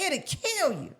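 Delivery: high-pitched imitation of mom; mom's voice. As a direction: play a mother giving a warning. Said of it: "She was like".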